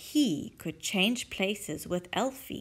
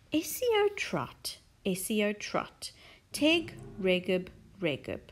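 mysterious incantation; slower than usual. Acted. Reading the backwards spell.

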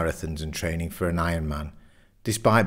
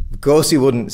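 loud, higher-pitched interjection; emphatic objection; 6 semitones higher, 8 dB louder than usual. A narrator's reading. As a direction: cut in firmly for your mate. Jeff cuts into the argument.

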